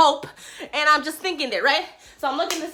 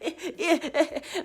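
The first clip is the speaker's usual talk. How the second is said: soft, drawn-out mock chuckle; mock laugh trailing on; imitated driver laugh; 10 dB quieter than usual.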